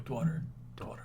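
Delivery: breathy Dwaughter, no voice; whispered soft W. Shown as a demonstration. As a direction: whisper Daughter with a soft W after the D. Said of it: "a soft W after the first D".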